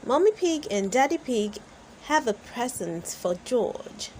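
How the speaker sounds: ordinary delivery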